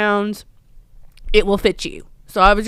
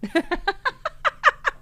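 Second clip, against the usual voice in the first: high, softer trailing giggle; helpless laugh; 12 semitones higher, 6 dB quieter than usual. Real, not acted. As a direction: giggle high and quietly after an outburst. Laughs at own outburst.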